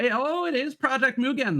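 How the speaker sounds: light up as you spot it; thrilled oh, it is Project Mugen; excited recognition. Real, not acted. Recognizes the game he wants.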